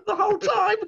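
high, loud laughing exclamation; laughing shout. Real, not acted. Laughing back at the friend's teasing.

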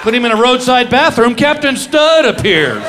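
high, loud sarcastic complaint; ticked-off wife. Retold or imitated. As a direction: imitate an annoyed wife mocking her husband. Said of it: "My wife was all ticked off".

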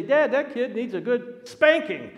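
loud, high mock indignation; emphatic retold outburst. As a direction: build to a loud, high punch on the last word. Retold call for a spanking.

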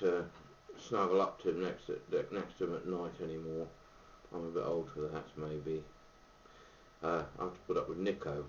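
talking around pipe stem; pipe in mouth. Real, not acted. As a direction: lips clenched on pipe. Seen 0: Pipe stem clearly held between lips while speaking; references hold it in hand.

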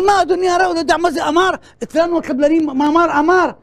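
loud, high-pitched defensive outburst; acted shouting. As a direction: imitate an indignant contractor shouting back. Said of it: "מה אתה צועק".

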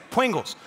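"Pringles" gezegd als "Pwingles", w in plaats van r; says w for r. Retold or imitated. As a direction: say "Pringles" with a w for the r, toddler style. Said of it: "'cause she can't say her R".

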